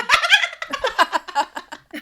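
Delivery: loud, high belly laugh; big laugh. Real, not acted. Host after her cartoon comparison, laughing helplessly. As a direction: crack up completely at your own joke.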